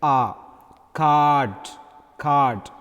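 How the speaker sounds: the ah alone, then card twice with it held; drawn-out ah in "card, card"; long ah. Shown as a demonstration. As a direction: lengthen the vowel in card. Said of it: "We pronounce it like".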